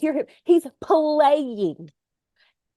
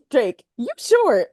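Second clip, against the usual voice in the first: mock [name]; slower than usual. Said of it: "[name] was like".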